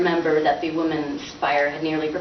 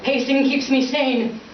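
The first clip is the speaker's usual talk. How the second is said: slow, higher, affronted father; horrified father; 6 semitones higher, slower than usual. Acted. Father snapping back at his son's request. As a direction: defend pacing with wounded pride.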